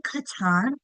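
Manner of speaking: KATAN as kuh-TAHN, imitating a learner's error; misread, end-stressed word. Shown as a demonstration. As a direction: stress the TAN of KATAN with a full ah. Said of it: "If they're saying katan".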